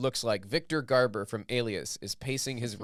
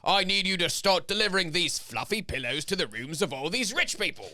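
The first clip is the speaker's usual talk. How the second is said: bossy mock British pillow man, higher by the end; stern British boss; 5 semitones higher than usual. Pillow man orders Jack around in a bossy mock British voice. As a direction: order the boy about like a snooty servant.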